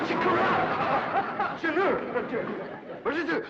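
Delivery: high, loud, stretched cry of shock; startled cry. Acted. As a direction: cry out as if waking from a spell. Shocked woman cries out.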